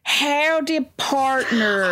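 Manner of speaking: a mock cowboy accent, punched out. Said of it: "like a cowboy".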